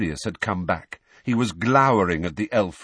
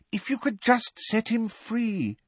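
put-on very high, earnest girl's voice; eager, pleading lilt up high; hopeful. A narrator's reading. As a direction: suggest it hopefully. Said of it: "said Hermione hopefully".

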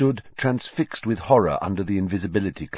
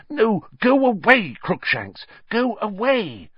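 higher, pleading shoo; moaning plea; 12 semitones higher than usual. A narrator's reading. Hermione shooing her cat off, moaning plea. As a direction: moan it, pleading the cat away. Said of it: "Hermione moaned".

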